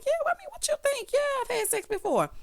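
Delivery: very high, put-on woman's answer; mock defensive reply. Host imitating her answer about sex, mock defensive.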